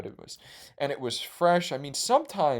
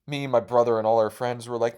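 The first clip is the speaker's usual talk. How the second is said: ordinary delivery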